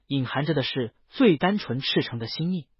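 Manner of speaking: ordinary delivery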